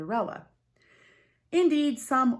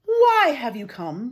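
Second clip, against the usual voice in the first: loud, slow, commanding question; stern demand; 5 semitones higher, 12 dB louder, slower than usual. Acted. The being's sister sternly questioning the two proud girls. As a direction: challenge them from the doorway.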